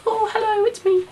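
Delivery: very high mock American voice; a failed American accent, squeaky and light; bad American accent. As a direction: Scarlet Witch played by a friend badly doing American. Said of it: "I can't do an American voice".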